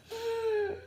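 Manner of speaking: high surprised gasp; sharp gasp. A narrator's reading. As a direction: gasp in alarm for the children. Robot catches the satellite.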